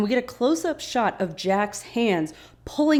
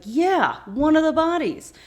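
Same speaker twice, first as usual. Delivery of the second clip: louder, higher cocky reply; brash answer; 8 semitones higher, 5 dB louder than usual. Retold or imitated. Sawyer explains where the gun came from, loud and cocky. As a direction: say it like it's no big deal. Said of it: "And he's like".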